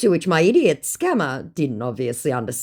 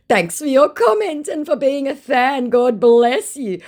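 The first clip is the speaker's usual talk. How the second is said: high, louder put-on gushing voice, slowing at the end; imitating the fake celebrity; 12 semitones higher, 6 dB louder than usual. Fake Hugh Jackman thanking a fan, high gushing mock voice.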